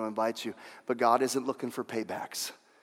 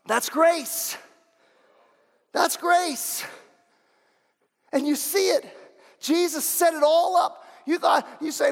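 much higher, loud preaching shout; preaching at full force; 14 semitones higher, 5 dB louder, slower than usual. Acted. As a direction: drive it home like a revival preacher. High intense preaching.

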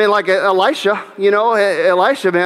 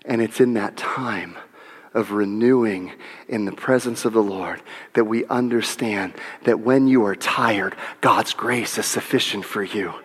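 hushed, softer; 7 semitones lower, 6 dB quieter than usual. Acted. Continues hushed.

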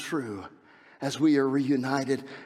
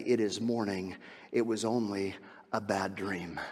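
gentle reassurance; 9 semitones lower, 8 dB quieter than usual. Acted. Soft comforting waking words.

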